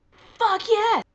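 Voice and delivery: high, loud fist-pump cheer; triumphant cheer. Real, not acted. Fan celebrating Aquaman staying on the surface, loud cheer. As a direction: cheer it like a win.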